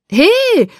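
high, loud call after them; shout. A narrator's reading. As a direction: shout Hey across the yard. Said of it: "rief Mike".

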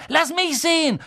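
put-on high-pitched insistent boy; demanding. Son wanting to see the letter, high and demanding. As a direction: insist on having a look. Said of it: "verlangte Dudley".

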